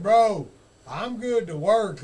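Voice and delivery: loud, slow, earnest buddy voice; mock earnest call, loud and drawn out; imitating Johnny, eager. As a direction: boom it out like a reassuring pal. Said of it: "he's like".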